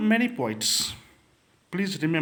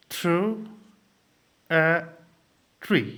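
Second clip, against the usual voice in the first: each word set apart by long pauses, syllables pulled slightly apart; much slower than usual. Shown as a demonstration. Teacher showing students the meter of a poem line, word by word. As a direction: read it out piece by piece for counting. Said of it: "Let me show you".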